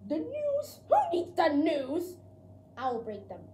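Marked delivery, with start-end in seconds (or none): 0.1-2.1 high, loud scoff at the news; scoffing dog. Acted. Dog scoffing at the news, mocking. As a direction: sneer it like a bratty pet.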